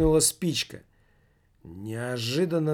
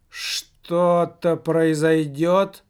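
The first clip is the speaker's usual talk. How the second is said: very slow, loud eerie question; otherworldly drawn-out question; not his own voice; 4 semitones higher, 6 dB louder, much slower than usual. Acted. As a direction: stretch it like a voice from beyond. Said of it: "не своим голосом спросил Михалыч".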